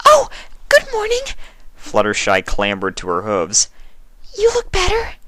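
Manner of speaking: surprised but friendly; timid pony, startled. A narrator's reading. Fluttershy greets changeling.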